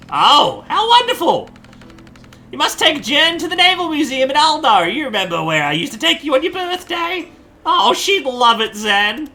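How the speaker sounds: put-on flustered old granny caught with a messy house; old lady voice. Ma greeting her son's surprise guest in a flustered old lady voice.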